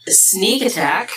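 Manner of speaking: ordinary talk, nothing marked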